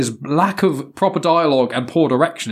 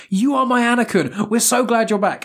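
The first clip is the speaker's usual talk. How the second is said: higher-pitched call of a cheering fan; retelling fans calling to Hayden Christensen, higher mock yell; 8 semitones higher than usual. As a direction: call out from the crowd like an adoring fan. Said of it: "people are yelling out".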